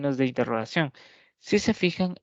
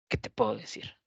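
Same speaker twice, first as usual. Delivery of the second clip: softer, lower, breathed "¿Qué te puedo decir?"; whispered exclamative question; 4 semitones lower, 5 dB quieter than usual. Shown as a demonstration. Teacher demonstrates the exclamative question for the class in a soft whisper. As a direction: drop to a whisper in resignation. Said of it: "con exclamación y pregunta a la vez".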